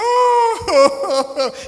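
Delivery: loud high laughter. Real, not acted. Laughs loudly.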